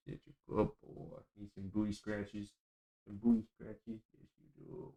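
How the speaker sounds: soft whispered praise. Real, not acted. Streamer whispering sweetly to his dog. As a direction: breathe it gently to the dog.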